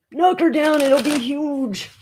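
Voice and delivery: high, slow mock Trump voice; a rough stab at Trump; failed Trump impersonation, drawn out slowly; Trump impression. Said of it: "I have not mastered a Trump impersonation".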